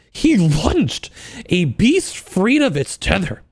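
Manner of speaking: slow, hushed romance-novel read; story narration. Acted. Reading smut aloud.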